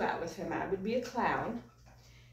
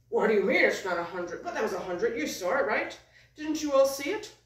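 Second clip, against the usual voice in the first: puppet protest, loud start rising to higher pitch; mock indignant; 3 semitones higher, 4 dB louder than usual. Puppet disputing the count with puppeteer, hammed-up indignation. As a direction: appeal in disbelief to the crowd.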